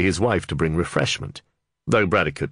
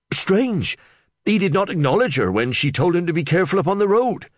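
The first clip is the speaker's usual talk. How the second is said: much higher, louder breathless blurting; excited Reeve; 13 semitones higher, 4 dB louder than usual. Acted. Flustered Reeve blurting news to the under-sheriff, much higher and louder. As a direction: tumble the news out, over-excited.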